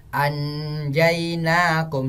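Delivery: "anjainakum" with a distinct n; chanted "anjainakum", n left unveiled; wrong clear-n recitation. Shown as a demonstration. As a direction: pronounce the n in "anjainakum" fully. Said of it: "Jangan dibaca".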